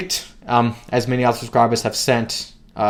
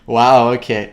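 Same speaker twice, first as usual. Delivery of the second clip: usual speaking voice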